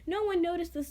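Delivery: ordinary delivery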